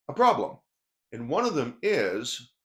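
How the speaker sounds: ordinary delivery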